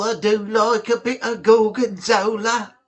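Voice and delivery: a put-on camp voice for the cheese line; hammed-up foodie voice, lightly done; funny voice. Chatting to stream chat about cheese, a put-on voice. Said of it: "I don't know why I did it in that voice".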